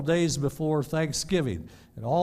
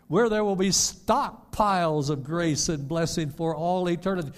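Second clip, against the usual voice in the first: raised, loud, fervent preacher's voice; full-force preaching; 6 semitones higher, 4 dB louder than usual. Acted. Pastor urging believers to hold onto heaven, preaching loud and high. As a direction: preach it out to the whole church.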